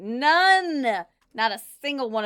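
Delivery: a sudden loud emphatic none; loud emphatic denial; loud exclamation. Real, not acted. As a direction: shout it out, high and loud.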